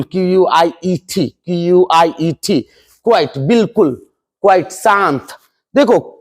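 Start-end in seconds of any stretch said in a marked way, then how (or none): 0.0-2.7 letters क्यू यू आई ई टी spelled out slowly, one by one; slow spelling aloud. Shown as a demonstration. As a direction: spell each letter of the word. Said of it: "ये उच्चारण का मतलब समझिएगा". (धीरे-धीरे spelling)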